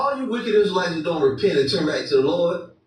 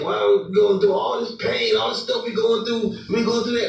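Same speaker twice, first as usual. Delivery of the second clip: mock whine; 7 semitones higher than usual. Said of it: "Right, Jacob say".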